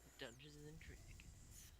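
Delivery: slow hushed whisper. Acted. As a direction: breathe it out slowly. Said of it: "full twenty-four hours".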